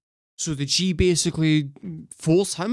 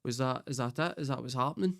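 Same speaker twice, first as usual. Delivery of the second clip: much softer, small, let-down voice; deflated mock question; 11 dB quieter, faster than usual. The girl alone at three in the morning, soft and let down. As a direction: murmur it, disappointed and confused. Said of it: "And then she's like".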